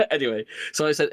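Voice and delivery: ordinary delivery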